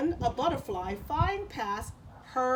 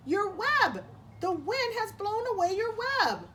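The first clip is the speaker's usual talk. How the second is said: high, louder alarmed cry; 10 semitones higher, 4 dB louder than usual. Acted. Cricket warns spider her web is gone, high and loud. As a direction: sound the alarm, pitched up.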